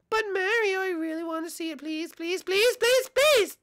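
high falsetto begging, building to squeals; Peach. Acted. Peach begs Mario.

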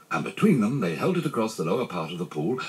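ordinary delivery, nothing marked

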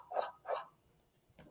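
voiceless wh, wh blown out; breathy wh sound. Shown as a demonstration. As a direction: blow the wh sound. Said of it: "sound wh".

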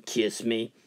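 low, soft croaking frog. Acted. Golfer hears a frog on the green, low soft frog voice. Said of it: "Frog says".

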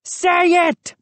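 high, put-on bullying command; insistent order. A narrator's reading. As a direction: insist he says it. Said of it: "Moose insisted".

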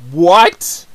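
loud incredulous "What?"; can't believe what you just heard; stunned what. Real, not acted. Reacting again to harsh line.

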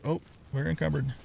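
ordinary talk, nothing marked

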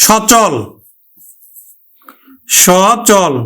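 সচল with open aw; teacher models সচল; pronunciation demo. Shown as a demonstration. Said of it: "যেমন".

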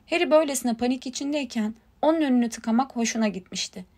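ordinary delivery

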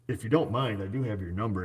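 ordinary talk, nothing marked

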